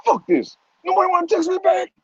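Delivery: high-pitched, worked-up fretting; comic high-pitched agitation; acted anxiety. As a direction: play anxiety spiralling over unanswered texts. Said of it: "your anxiety gets so high".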